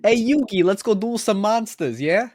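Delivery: high, hammy Brooklyn tough guy; overdone Brooklyn cartoon voice; bad Brooklyn accent. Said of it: "a bad Brooklyn accent".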